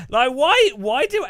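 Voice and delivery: high, loud disbelieving why; incredulous. Real, not acted. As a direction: blurt it out in disbelief. Incredulous outburst about eye filters.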